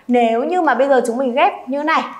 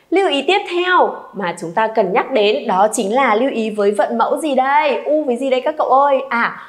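normal talking voice

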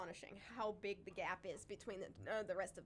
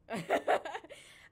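loud, higher burst of laughter; 6 semitones higher, 12 dB louder than usual. Real, not acted. Host laughs about facts stuck in her brain, loudly.

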